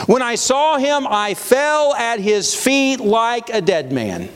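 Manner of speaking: high, slow Bible reading; proclaimed scripture. A narrator's reading. Preacher reading John's reaction to the vision, proclaiming. Reads scripture aloud to congregation.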